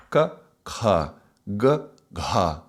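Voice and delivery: k then breathy "ka", g then breathy "gha"; unaspirated and aspirated pairs. Shown as a demonstration. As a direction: push air after "ka" and "gha". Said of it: "Difference from k".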